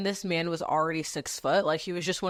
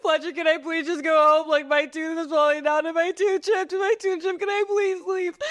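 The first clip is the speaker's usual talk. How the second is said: imitated high, loud sobbing plea; hysterical tearful pleading; 8 semitones higher, 4 dB louder than usual. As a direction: blubber it to the manager, begging to go. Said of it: "this is when I lost my shit".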